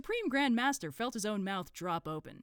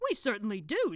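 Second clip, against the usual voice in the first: vehement agreement, a little louder; 4 semitones higher, 6 dB louder than usual. Acted. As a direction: agree with force, in character. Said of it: "said Brother Doorkeeper vehemently".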